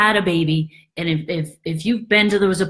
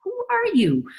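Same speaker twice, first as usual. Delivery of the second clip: higher, quick, breezy doctor; 12 semitones higher than usual. Retold or imitated. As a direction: play the brisk nurse checking you over.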